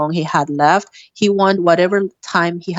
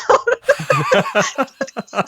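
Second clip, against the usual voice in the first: breaks into a high, teary laugh; laughing through tears, caught off guard; a real high laugh close to crying; tearful high laugh. Real, not acted. Emotional laugh at dad's reply.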